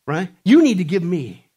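loud, mocking whine of the entitled; entitled complainer. Preacher mocking the world's entitlement, hammed-up whine. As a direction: mimic a demanding, spoiled voice. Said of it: "this mentality like".

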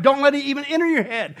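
ordinary talk, nothing marked

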